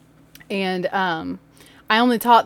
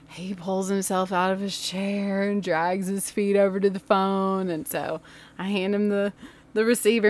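ordinary delivery, nothing marked

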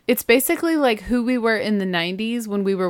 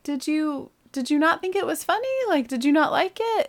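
put-on higher, unsure voice asking the kids; a worried, higher check-in; concerned mom question; 8 semitones higher than usual. Mom asks kids about her laugh.

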